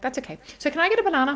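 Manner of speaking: put-on a kid already over it, asking for a banana; cheery child. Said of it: "she was like".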